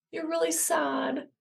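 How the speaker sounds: put-on gently mirror a child's sadness; tender empathy. Shown as a demonstration. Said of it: "communicate that you are receiving their feelings".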